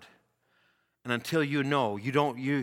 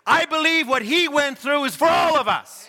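high, driving preacher cadence; full-force preaching; 9 semitones higher, 5 dB louder than usual. Acted. Preacher telling congregation of a suffering minister, driving high preaching. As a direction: build each phrase as a ringing refrain.

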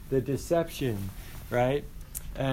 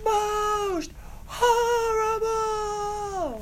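very high, extremely drawn-out wail; hammed-up howl of torment; imitated stage yell; much slower than usual. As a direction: stretch each word into a long ghostly cry. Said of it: "He's yelling at the top of his lungs".

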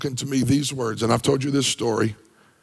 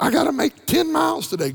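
high, bright mock runner's chirp; eager jumper. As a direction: voice the keen jumper, pitched way up. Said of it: "If you're a jumper and you're like".

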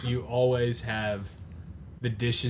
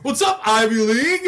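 loud, much higher teasing voice; mocking cousin; 11 semitones higher, 9 dB louder than usual. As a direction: rib the new boyfriend in a hammed-up sing-song. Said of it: "they start".

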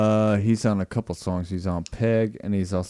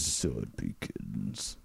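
lower, slow, soft epic announcer; ominous lowered title read; deep trailer voice; 11 semitones lower, 12 dB quieter, much slower than usual. Acted. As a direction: drag it out like a doom movie trailer. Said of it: "You like how I say that?".

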